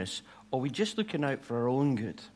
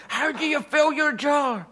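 put-on higher, a touch louder, mildly whiny kid; frustrated little boy; 11 semitones higher, 4 dB louder than usual. Boy pestering the girl for her secret, high frustrated voice. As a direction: let a small boy's frustration push the pitch up. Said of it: "the boy was frustrated. He went".

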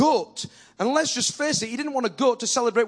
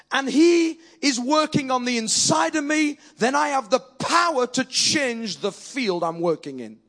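preaching; 4 semitones higher, slower than usual. Acted. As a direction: build it like a revival preacher. Preaching at full force.

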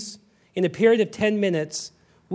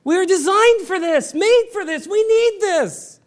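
high, loud, building pleas; impassioned preaching; 11 semitones higher, 3 dB louder than usual. Acted. Preacher pleading with the congregation, high and loud. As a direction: preach it with rising fervour.

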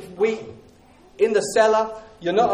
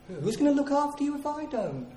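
higher, softer, mock-polite worry; polite stronghold; 6 semitones higher, 7 dB quieter than usual. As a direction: fake concern, sweetly polite. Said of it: "the stronghold says politely".

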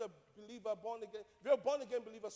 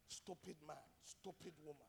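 low and much softer; muttered insult; 6 semitones lower, 16 dB quieter than usual. Retold or imitated. Pastor quotes spouses' insults to each other, muttered low. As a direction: imitate insults under the breath.

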